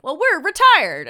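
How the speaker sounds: high, loud, slow Southern voice; hammed-up Southern old-timer protesting; awful Southern accent. Old dude to the man whose car was towed, awful Southern accent. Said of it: "in awful Southern accents".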